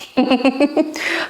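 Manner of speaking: high giggle; laugh. Real, not acted. Laughing after the gym story, high giggle. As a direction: giggle at the memory.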